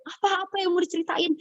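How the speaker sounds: high, eager question; pressing eagerly for the story; excited asking. Retold or imitated. Friend eagerly asking what the funny story is. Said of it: "saya udah excited".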